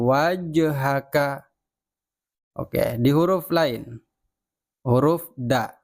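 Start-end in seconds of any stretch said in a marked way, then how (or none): 0.0-1.5 "Wajhaka" slow, the j bouncing into a light vowel, "waja-haka"; bouncing jim. Shown as a demonstration. Teacher models the bouncing jim for pupils, slow. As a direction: let the j in "Wajhaka" rebound. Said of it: "Jadi dibacanya memantul".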